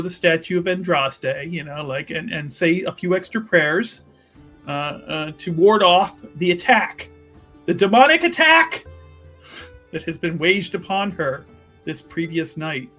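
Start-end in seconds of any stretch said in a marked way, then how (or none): 5.4-8.8 hammed-up dramatic build; mock-dramatic emphasis. Real, not acted. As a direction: build to a high, loud dramatic peak. Dramatic recap of character's plight.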